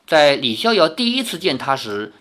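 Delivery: ordinary delivery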